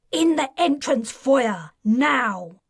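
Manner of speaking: loud, angry command to the foyer; furious order. A narrator's reading. Brigadier orders the caught major out, furious. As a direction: bark the order like a furious officer. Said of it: "furious, glaring at him".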